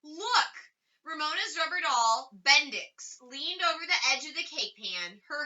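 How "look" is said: urgent call to look; alarmed shout. A narrator's reading. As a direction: point at the disaster. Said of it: "horrified at what she saw".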